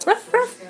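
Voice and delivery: high playful dog bark. Pretend. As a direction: bark it out for the baby. Mother voices dog for toddler.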